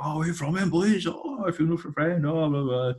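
chatty mock Valleys accent, voice lifted up; Welsh Valleys accent. Dad greets the touring band in a Valleys accent. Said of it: "Welsh accent or, you know, a Valleys accent".

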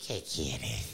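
put-on low old witch voice; old Baba Yaga voice. Baba Yaga inviting the hero inside, low old crone voice.

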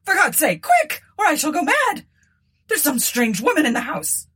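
high-pitched panicked cry; rising scream. A narrator's reading. Terrified Tabitha calls the old woman downstairs, screaming. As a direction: shriek for help as if losing your mind. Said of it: "Her voice rose suddenly to a scream".

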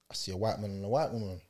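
ordinary talk

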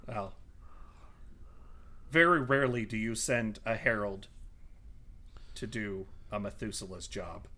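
low, measured villain voice; quiet, deliberate disdain with long pauses; disdainful villain. Acted. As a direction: sink softly to a low close. Villain speaks to party in character.

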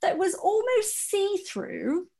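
high, slow, laughing through it; laugh-crying. Real, not acted. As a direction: wail it out between laughs. Laughing at her own humiliation.

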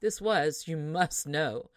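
ordinary delivery, nothing marked

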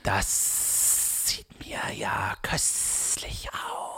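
slow, whispered serpent hiss; hissing snake whisper. Acted. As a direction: savour it like a hungry snake. Snake eyes the cheese.

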